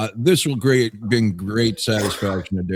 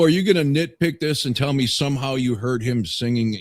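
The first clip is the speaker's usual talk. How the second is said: normal talking voice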